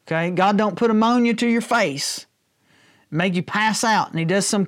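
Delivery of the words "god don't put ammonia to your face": ordinary talk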